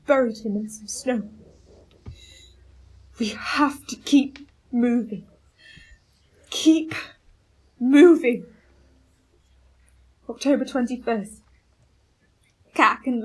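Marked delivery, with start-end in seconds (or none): 3.2-5.2 slow, exhausted whisper; weary whisper. Acted. Starving captain whispering to push on, weary. 6.5-8.5 louder, higher push to go on; rally the men with the last strength; forceful urging. Acted. Captain forcing the dying men onward, loud.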